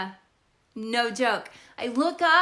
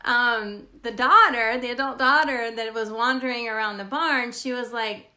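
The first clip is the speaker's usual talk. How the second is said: usual speaking voice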